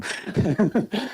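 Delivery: a brief, breathy real laugh rising up; short laugh. Real, not acted. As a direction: laugh it off modestly. Laughs after thanking the chair.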